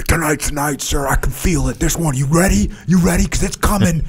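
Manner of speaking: half-mock foreboding warning; ominous doomsayer. Soldier telling his officer tonight they die, mock ominous. Paranoid soldier predicting their deaths.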